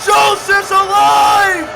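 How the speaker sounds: loud, high excited match call; live play-by-play. Acted. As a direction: scream the big spots to the crowd.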